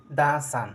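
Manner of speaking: দা সান with দা as a short "duh"; দা before the consonant sound, like "duh sun". Shown as a demonstration. Teacher giving a consonant example, দা as "duh". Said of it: "যেমন".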